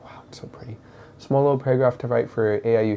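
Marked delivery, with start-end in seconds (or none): 0.0-0.8 whispering. Real, not acted. Quiet aside while studying.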